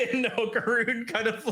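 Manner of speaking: usual speaking voice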